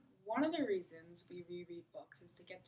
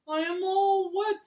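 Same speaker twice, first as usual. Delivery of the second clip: Toad's voice; 10 semitones higher, 12 dB louder, slower than usual. Acted. Toad complains in read-aloud.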